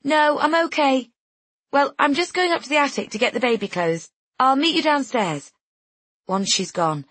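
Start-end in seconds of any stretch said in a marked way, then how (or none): 0.0-1.1 high, raised refusal; calling back. A narrator's reading. Guest declines help through door, calling back. 1.7-5.5 high-pitched, projected sign-off; calling through a door. A narrator's reading. Friend announces she is leaving, calling out.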